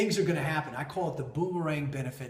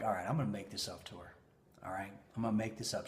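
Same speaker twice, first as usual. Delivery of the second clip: quick, much softer, low self-talk; muttered resolve; 6 semitones lower, 9 dB quieter, much faster than usual. Retold or imitated. Husband quietly vowing to make it up to wife, muttering. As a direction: hustle the plan under your breath.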